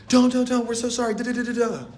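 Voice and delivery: high, panicked mock pleading; hammed-up brothers begging forgiveness; begging for mercy. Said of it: "begging for mercy".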